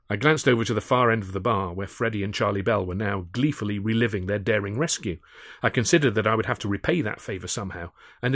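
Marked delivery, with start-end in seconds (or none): none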